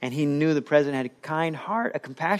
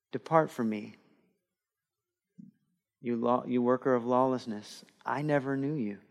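grave rejection; 3 semitones lower, 8 dB quieter than usual. Acted. Jesus rejecting at judgment.

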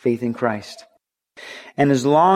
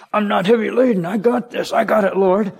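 much higher, dismissive brush-off of a voice; proud believer brushing off Jesus, voiced higher and mocking; mock stubborn self-reliant believer; 8 semitones higher than usual. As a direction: wave off help like a proud know-it-all.

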